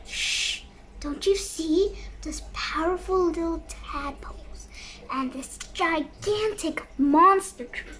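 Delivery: hushed secret whisper; whispering a secret plan. Acted. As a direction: whisper it so they can't hear. Secret aside to partner.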